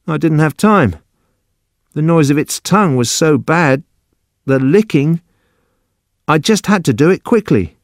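high-pitched, urgent excuse; agitated, defensive Judson. Acted. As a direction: plead your case in a high voice. Narrator voices Judson justifying himself.

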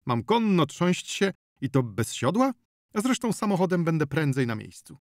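high-pitched indignant protest. A narrator's reading. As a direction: object in affronted disbelief. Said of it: "oburzył się Pawlicki".

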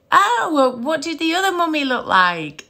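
high-pitched, sing-song question; retold mum to child. Mum asks daughter about the other mummy, retold, high and light.

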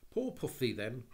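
soothing coax. A narrator's reading. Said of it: "was all that she could think of to say".

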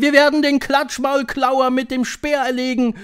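high, loud voice of a rowdy coven; high-pitched, boisterous crowd; bawling. A narrator's reading. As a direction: boast noisily like an excited crowd. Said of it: "grölten sie durcheinander".

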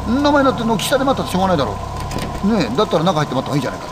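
high, quick coaxing voice; pushy friend. Acted. Friend urges going inside.

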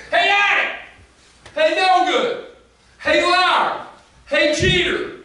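high, loud taunting crowd; mocking jeers. Crowd jeering insults at the woman, high and loud. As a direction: jeer the names at her like a mob.

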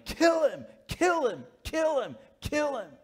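high chanted shout of kill him; repeated high mob chant; mob yelling. Retold or imitated. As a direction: yell it like a baying mob. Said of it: "And they started yelling".

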